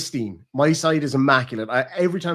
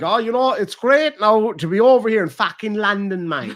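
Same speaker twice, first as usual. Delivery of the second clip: high, loud Irishman putting on Cockney; hammed-up East End accent, landing hard on London; badly faked Cockney, pitched up and loud; mock East End London; 7 semitones higher, 5 dB louder than usual. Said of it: "You're in the East End of London".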